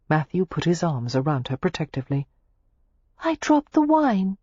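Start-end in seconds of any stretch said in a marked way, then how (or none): 3.2-4.4 high, slow, sorry little lament; rueful over the lost wine; regretful. A narrator's reading. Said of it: "Daisy said regretfully".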